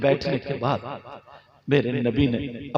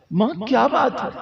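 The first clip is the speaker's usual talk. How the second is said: the same question pitched higher, more urgent; higher repeated question; 8 semitones higher than usual. Acted. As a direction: ask again, higher and more insistent. (ऊँची आवाज़ में दोबारा पूछना)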